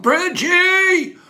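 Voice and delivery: long high drawn-out yell of the name. Real, not acted. Livestream host yelling a viewer's name in greeting, drawn-out yell. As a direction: call the name out loud and long. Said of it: "so I could yell the name out loud".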